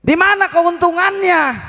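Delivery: high-pitched ringing question; preacher's soaring call-out; rhetorical call. Real, not acted. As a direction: throw the question out high to the crowd.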